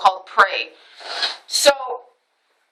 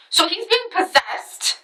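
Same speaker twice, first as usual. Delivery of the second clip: higher, slow, ominous pronouncement; slow eerie "he's being possessed"; dramatic; 7 semitones higher, slower than usual. Shown as a demonstration. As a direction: lean into it like a spooky reveal. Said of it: "In a, in a fashion".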